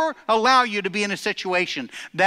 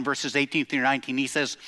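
normal talking voice

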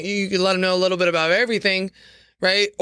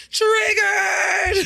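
mock outraged shout; 14 semitones higher than usual. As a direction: yell it like an outraged troll. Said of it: "someone is gonna be like".

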